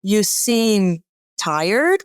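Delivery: very slow, rising question at the end. Shown as a demonstration. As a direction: ask it gently with a lift at the end. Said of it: "question mark in your voice".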